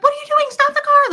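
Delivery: high and loud panicked yell; retold scream. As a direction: yell it as a terrified mom. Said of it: "she's screaming".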